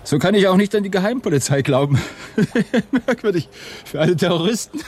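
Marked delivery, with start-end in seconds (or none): 2.0-3.0 short bitter laugh. Acted. Mocking laugh about the police.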